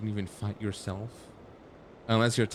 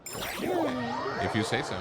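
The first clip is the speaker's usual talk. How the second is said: sarcastic doubt; much slower than usual. Real, not acted. Doubting the general's claim.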